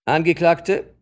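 loud, high address from the bench; forceful courtroom pronouncement; stern judge. A narrator's reading. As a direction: address the accused with full authority.

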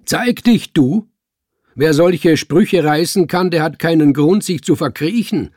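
loud, high, cutting demand; sharp. A narrator's reading. Man at window ordering hidden speaker out, sharp. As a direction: challenge him angrily, with a hard edge. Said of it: "nun war ordentlich Schärfe in seinen Worten".

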